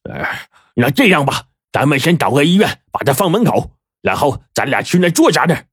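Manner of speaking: decide it matter-of-factly; lightly voiced boss. A narrator's reading. Boss tells his henchman to leave the man at a hospital.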